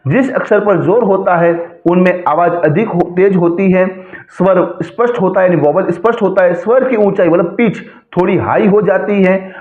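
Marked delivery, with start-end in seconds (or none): none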